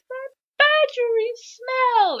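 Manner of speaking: high, loud, stretched-out relish; hammed-up ecstatic high voice; excited character impression. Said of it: "and he smells him".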